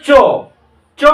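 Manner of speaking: চ made with the tongue at the palate; palatal চ. Shown as a demonstration. Teacher shows class where চ is sounded, palatal চ. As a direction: palatal চ, said alone and clearly. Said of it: "এই তালব্য ধ্বনিকে চ বলা হবে".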